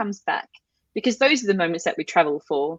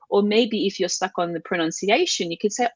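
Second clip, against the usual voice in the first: ordinary talk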